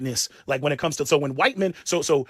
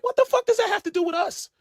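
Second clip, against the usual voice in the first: much higher, incredulous shout-question; what the hell, nothing to do with us; exasperated outburst; 12 semitones higher than usual. Retold or imitated. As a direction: blow up in disbelief. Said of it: "I'm like".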